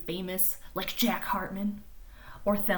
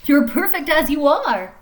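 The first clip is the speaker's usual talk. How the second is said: cheerful friend; 7 semitones higher, 12 dB louder than usual. Acted. Friend Otis reassures Thelma.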